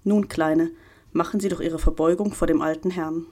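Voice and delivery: usual speaking voice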